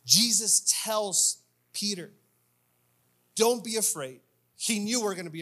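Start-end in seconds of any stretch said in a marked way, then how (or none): none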